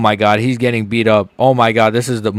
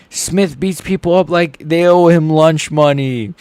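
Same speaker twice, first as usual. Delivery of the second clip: slow, higher, gravelly delivery; nasty voice; 6 semitones higher, 4 dB louder, slower than usual. Real, not acted. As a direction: drag it out with a nasty edge. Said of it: "Sorry, that was nasty".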